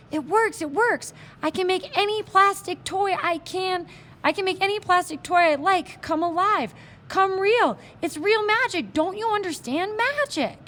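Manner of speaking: high, loud, giddy rush; excited. Acted. Boy shouts to Indian about the magic, high thrilled voice. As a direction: bubble over with discovery. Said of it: "this display of excitement".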